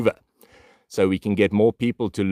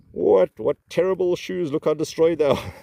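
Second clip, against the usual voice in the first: much higher, put-on appalled voice; mock disgust; 9 semitones higher than usual. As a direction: pretend to be appalled by the shoes.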